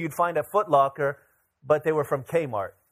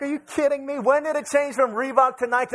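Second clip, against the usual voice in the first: disbelief; 9 semitones higher, 4 dB louder than usual. Retold or imitated. Shoes trend changed again.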